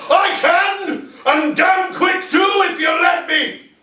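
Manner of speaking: high, loud shout back; yelled reply. Acted. Colonel yells back at the general, high loud shout. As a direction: yell it back with full force. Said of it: "he yells back".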